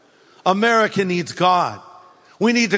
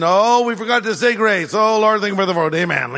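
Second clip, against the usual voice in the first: rushed grace. Retold or imitated. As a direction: rattle it off quickly, a throwaway mealtime prayer. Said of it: "those rushed prayers".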